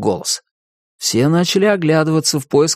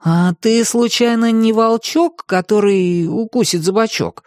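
put-on high-pitched boy's sly question; curious boy Tim; 9 semitones higher than usual. A narrator's reading. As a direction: ask teasingly as a boy.

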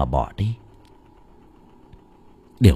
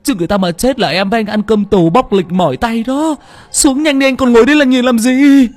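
much higher, louder frantic wailing; panicked tearful pleading; 10 semitones higher, 5 dB louder than usual. A narrator's reading. Assistant begging his boss to check the body, frantic tearful wail. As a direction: beg him to get out, near hysteria. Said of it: "Thằng Tiên vừa nói, vừa mếu máo".

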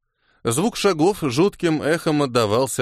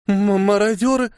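high, stammered cry of horror; horrified; 8 semitones higher than usual. A narrator's reading. Girl traveller spots the marauders, horrified high stammer. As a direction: stammer it out in terror, pitched well up. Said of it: "в ужасе прошептала девушка".

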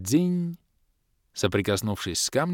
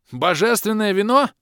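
high, loud yell with вино rising; 12 semitones higher, 6 dB louder than usual. A narrator's reading. Bom yelling as the divine wine appears. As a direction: shout it out in greedy alarm. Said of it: "заорал Бом".

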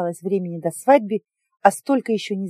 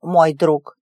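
jealous; 4 semitones lower, 5 dB louder than usual. A narrator's reading. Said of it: "добавил ревниво".

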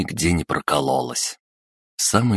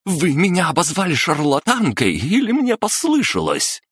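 outraged shout; 13 semitones higher than usual. A narrator's reading. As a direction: scream the accusation back. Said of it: "закричала Анна Викторовна".

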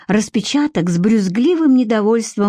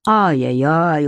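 low, slow mock tut-tutting; reproachful sigh; 4 semitones lower, slower than usual. A narrator's reading. Miron chides cursing Vika, reproachful. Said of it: "укоризненно вздохнул Мирон".

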